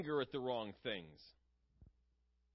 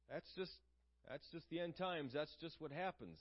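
softer, mildly dismissive excuse; resigned shrug; 7 dB quieter than usual. Acted. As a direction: shrug it off quietly as inevitable. Voicing complacent excuse for inaction.

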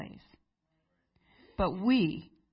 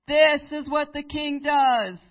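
slow, much higher and louder public cry; forced proclamation; 9 semitones higher, 11 dB louder, slower than usual. Shown as a demonstration. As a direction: bellow it through the city streets, slowly. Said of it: "not quite loud enough. Come on, speak up".

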